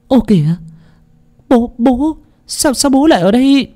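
put-on high, shocked stammer of a young wife; startled cry. A narrator's reading. Young wife catching her father-in-law spying, startled. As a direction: gasp it out in alarm. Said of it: "Cô Lan thốt lên".

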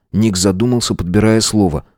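ordinary delivery, nothing marked

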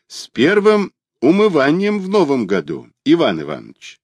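servant's greeting. Acted.

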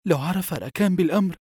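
high-pitched, fearful warning; daughter's storybook alarm; acted fear. A narrator's reading. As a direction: voice the worry with pitch well up. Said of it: "فقالت ابنته بخوف".

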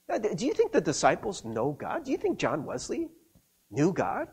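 usual speaking voice

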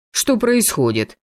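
high, flustered question; alarmed. A narrator's reading. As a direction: ask it in mild alarm. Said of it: "всполошилась комиссия". (всполошённый вопрос)

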